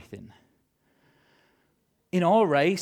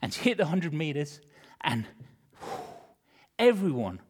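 normal talking voice

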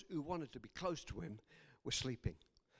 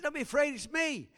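raised, higher voice calling across water; calling out reassurance; 9 semitones higher, 8 dB louder than usual. Acted. Jesus calls to terrified disciples on the water, calling out. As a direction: call out loudly over their fear.

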